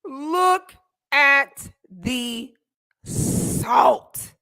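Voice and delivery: very slow, loud, word by word; drawn-out mockery. Real, not acted. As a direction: savor each word, stretching SALT out. Repeats mocking remark, drawn out.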